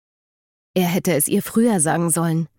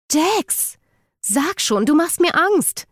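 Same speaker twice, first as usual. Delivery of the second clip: viel höher, angstvoll flehend; ängstlich; 11 semitones higher, slower than usual. Acted. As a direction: fleht ihn hoch und ängstlich an. Eden verängstigt, fasst seine Hand. (Eden fleht Jackson voller Angst an, viel höher)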